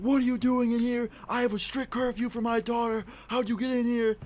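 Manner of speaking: high-pitched mock dad yelling; retold angry screaming. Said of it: "he starts screaming at me".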